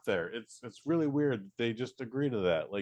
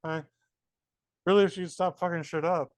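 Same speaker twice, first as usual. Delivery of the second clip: exasperated plea; 7 semitones higher than usual. Retold or imitated. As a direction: plead with them to knock it off. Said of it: "I'm like".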